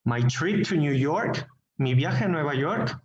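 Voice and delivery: "York" swept upward as a checking question; clarifying question intonation. Shown as a demonstration. Teacher modelling a request for clarification, rising on "York". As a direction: echo it back, rising on "York". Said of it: "intonation for clarification".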